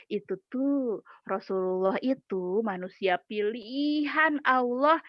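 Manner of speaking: usual speaking voice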